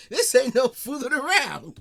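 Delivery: high, loud mock quote; punchy, high-pitched comic imitation; mimicking the comedian. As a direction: parrot the line, amused and emphatic. Mimicking the comedian's line, amused.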